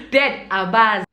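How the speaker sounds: ordinary talk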